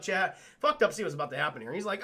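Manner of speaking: usual speaking voice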